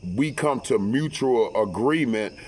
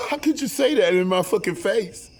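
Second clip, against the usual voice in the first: outraged wife; 8 semitones higher than usual. Retold or imitated. Wife yelling back at his cruel remark, mock outraged shriek.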